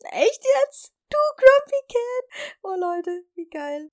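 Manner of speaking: gleeful, high and loud; excited disbelief. Real, not acted. Amused disbelief at nickname.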